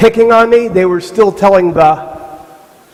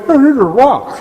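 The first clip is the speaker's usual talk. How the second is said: higher, lightly put-on kid voice; mock complaint, pitched up; mimicking a complaining kid; 6 semitones higher than usual. Mimicking kid who bit the rock.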